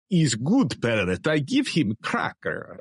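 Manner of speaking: put-on slow Putin voice in a Russian accent; broken English with a Russian accent. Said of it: "you do a great Russian accent".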